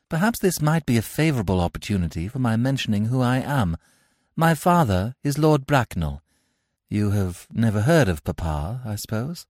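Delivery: a hint of a gushing Victorian lady; lightly voiced haughty lady. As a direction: play a grand lady being charming. Gwendolen voiced in read play.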